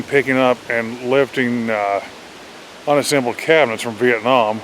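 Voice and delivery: ordinary delivery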